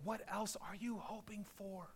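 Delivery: soft, half-whispered pleading question; gentle, searching whisper to the congregation; hushed appeal. Real, not acted. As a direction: drop to a near whisper and draw it out.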